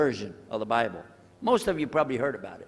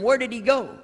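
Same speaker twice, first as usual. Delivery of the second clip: alarmed question; 8 semitones higher, 4 dB louder than usual. Retold or imitated. Asking where the priest went.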